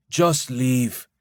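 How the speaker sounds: ordinary delivery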